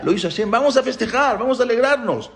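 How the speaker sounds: high-pitched festive exclamation; jubilant. Acted. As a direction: call everyone to celebrate, voice lifted high. Sons rejoice and call for celebration.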